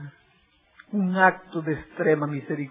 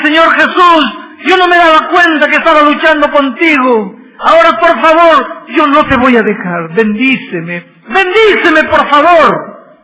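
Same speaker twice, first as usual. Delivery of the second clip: desperate plea; 10 semitones higher, 16 dB louder than usual. Acted. Jacob clinging, begging for blessing.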